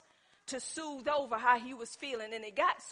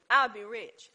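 normal talking voice

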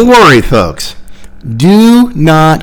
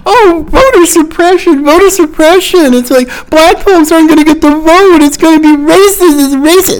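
high, put-on whiny voice mocking the shouted complaints; mocking whiny screamer; 10 semitones higher than usual. Commentator mocks the media and Democrats' complaints in a high sneering whine. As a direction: parrot them in a sneering, higher whine. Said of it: "They're screaming about".

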